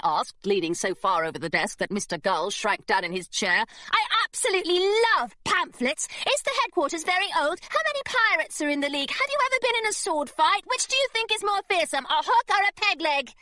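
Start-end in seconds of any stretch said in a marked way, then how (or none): 3.9-13.3 excited gushing. Acted. Girl gushing to distract secretary.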